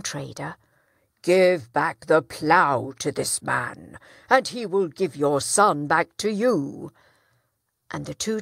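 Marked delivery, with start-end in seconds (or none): 1.2-6.9 judge's ruling. Acted. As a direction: pronounce judgement firmly. Judge's verdict.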